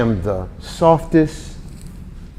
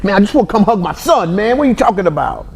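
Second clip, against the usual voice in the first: mushy dad pleading; 7 semitones higher than usual. Retold or imitated.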